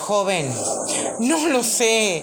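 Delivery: loud, high, frantic plea; panicked caller. Acted. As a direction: beg for help in a panic.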